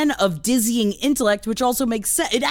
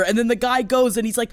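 normal talking voice

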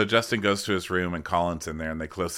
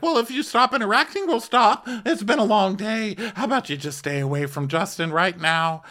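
very high, put-on protesting voice; hammed-up high-pitched telling-off; imitated exasperated pleading; 12 semitones higher, 3 dB louder than usual. Crew member telling guest to stay away, high mock exasperation.

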